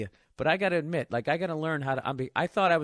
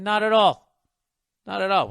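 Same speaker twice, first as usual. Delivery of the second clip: loud, higher, insistent reassurance; hearty dismissal; 4 semitones higher, 7 dB louder than usual. Retold or imitated. Host brushing off guest's apology, loudly insistent.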